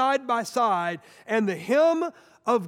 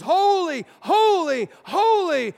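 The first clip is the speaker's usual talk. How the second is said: louder, higher, ringing angel chant; angels crying holy; 7 semitones higher, 6 dB louder, slower than usual. Retold or imitated. Angels around the throne crying out, a ringing chant. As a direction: call it out like heaven's choir. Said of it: "he's hearing angels".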